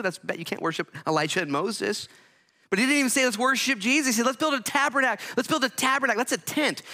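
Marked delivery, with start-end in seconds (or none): 2.7-6.8 high, loud preacher's push; preaching at full force. Real, not acted. Preacher mocking Peter's tent idea, loud and high. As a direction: press the point hard to the church.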